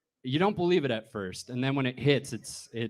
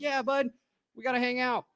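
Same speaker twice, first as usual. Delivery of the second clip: higher, woozy agreement; drunk buddy voice; 9 semitones higher than usual. Retold or imitated. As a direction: agree like you're half-cut. Speaker's drunk reply.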